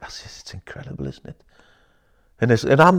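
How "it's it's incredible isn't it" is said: soft, breathy, almost voiceless; hushed awe. Real, not acted. Coach softly marvelling at clients' emotional release. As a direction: let the wonder hush the voice. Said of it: "crying with it".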